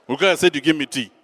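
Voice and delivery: loud, abrupt order for tea; acted impatient insistence; agitated demand. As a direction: demand it with no greeting. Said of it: "she can see that I'm agitated".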